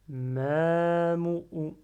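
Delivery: MAMOU with the first vowel held long and loud; prolonged vowel. Shown as a demonstration. Teacher showing learners where to prolong, drawn-out Mamou. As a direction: stretch out the "ma" in Mamou. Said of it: "Là, je prolonge".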